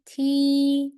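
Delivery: "T" held high and level; first tone T. Shown as a demonstration. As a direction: sing "T" flat and high, first tone. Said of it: "first tone".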